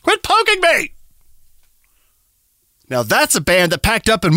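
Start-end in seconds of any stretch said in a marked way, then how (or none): 0.0-0.9 exasperated poked game character. Acted. Decade personified as pointy cactus.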